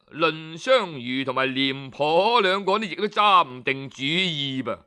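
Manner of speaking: ordinary delivery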